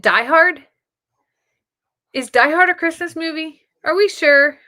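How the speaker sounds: loud, high disbelieving double take; surprised. Real, not acted. Reading a viewer's comment, loud surprised disbelief. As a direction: sound shocked at the suggestion.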